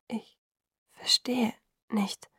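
very slow, soft, broken words; nearly voiceless. A narrator's reading. Bella unable to grasp the news, nearly voiceless. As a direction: barely mouth it, word by word. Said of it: "formte die Worte stumm mit den Lippen".

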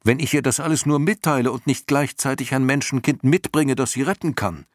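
deutlich höher gerufener Einwand; aufgebrachter Ausruf. A narrator's reading. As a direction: mit hoher Stimme aufgebracht einwenden. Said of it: "rief Atreo". (aufgebracht gerufen, in hoher Tonlage)

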